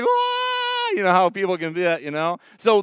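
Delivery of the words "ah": crowd reaction. Retold or imitated. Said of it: "people were like".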